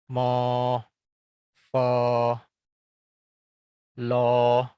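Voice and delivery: each syllable held level and loud, one by one; first tone drill. Shown as a demonstration. Teacher leads students through a pinyin first-tone drill, calling out each syllable. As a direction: call out each first-tone syllable evenly, pausing between. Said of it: "Oke, nada pertama".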